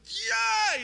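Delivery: sudden high, loud yelp of excitement; high excited yelp. Retold or imitated. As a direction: burst out like a teenager who just saw her. Excited boys spotting the girl.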